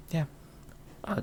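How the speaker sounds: ordinary talk, nothing marked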